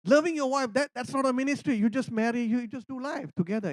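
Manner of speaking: high, scoffing objection; mocking disbelief at the idea; incredulous retort. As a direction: toss it back in disbelief. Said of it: "I said".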